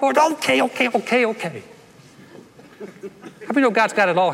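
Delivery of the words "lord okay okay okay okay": mock hurried okays. God hurriedly acknowledging.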